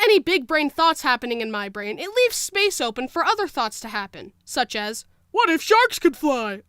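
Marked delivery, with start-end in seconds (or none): 5.3-6.6 slow, dopey dreamy voice. Pretend. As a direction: say it like a dim daydreamer. Voicing a smooth-brain thought.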